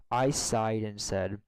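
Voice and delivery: ordinary delivery, nothing marked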